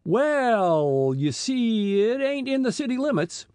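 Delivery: drawn-out, high, reluctant hedge; hedging with pursed lips. A narrator's reading. Police chief making excuses to the boys, pursed-lip hedging. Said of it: "pursing his lips".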